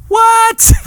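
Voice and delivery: very high, loud mock disbelief; incredulous squawk. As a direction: burst out in a loud falsetto of disbelief. Acted doctor's disbelief at question.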